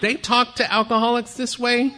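high, pearl-clutching gasp of a question; scandalised disbelief. Retold or imitated. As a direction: act mock-offended, voice up high. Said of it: "I'm like".

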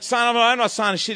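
loud, high-pitched indignation; retold defiant refusal. New prisoner told to sign papers, loudly defiant. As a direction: refuse outright, worked up.

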